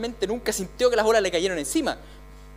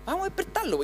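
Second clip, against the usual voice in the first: lightly voiced disciples, higher and eager; a hint of fishermen plotting to wake him up; disciples urging; 4 semitones higher, 6 dB quieter than usual. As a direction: play the disciples huddling to wake the master. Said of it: "todos se juntaron y dijeron".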